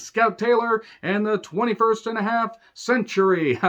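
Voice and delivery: high, giddy cartoon-announcer delivery; mock title announcement. Reviewer joking on chapter titles, high mock announcer.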